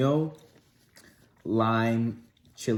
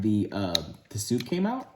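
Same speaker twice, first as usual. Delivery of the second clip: ordinary talk, nothing marked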